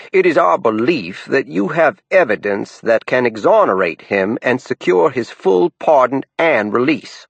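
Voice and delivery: put-on aunt pressing in Down East accent. A narrator's reading. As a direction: plead formally in Down East. Lou Bates dialogue.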